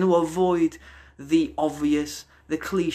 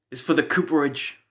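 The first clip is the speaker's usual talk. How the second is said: usual speaking voice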